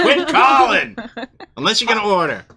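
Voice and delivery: laughing mock shout; mock yell. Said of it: "I'm like".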